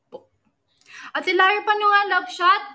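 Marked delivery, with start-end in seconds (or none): 1.1-2.6 loud, high call across to someone. Real, not acted. Host asking Ate Lara about the love shot, calling out. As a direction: call the question out, pitched up.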